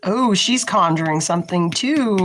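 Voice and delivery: normal talking voice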